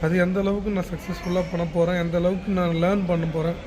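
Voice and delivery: ordinary talk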